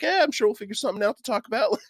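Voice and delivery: laid-back dude. Retold or imitated. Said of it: "He's like".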